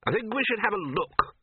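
a hearty host waving off the hunt; Ridcully's voice. Acted. Ridcully decides.

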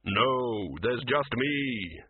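very slow, grave Death voice. A narrator's reading.

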